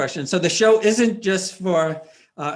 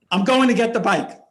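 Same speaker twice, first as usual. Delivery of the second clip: higher, louder imitation of mom; mom's determined announcement; 7 semitones higher, 6 dB louder than usual. Mom putting the bike before her hurt child, loud mock determination.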